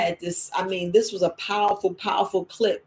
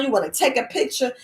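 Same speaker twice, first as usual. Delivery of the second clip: normal talking voice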